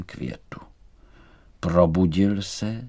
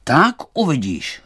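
stern warning; 6 semitones higher, 5 dB louder than usual. A narrator's reading. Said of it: "svítil přísně".

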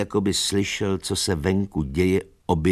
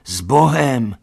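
louder, higher farewell call; calling goodbye; 7 semitones higher, 7 dB louder, slower than usual. A narrator's reading. Animals call after departing Boxer, loud farewell. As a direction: call goodbye across the yard. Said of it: "volali všichni".

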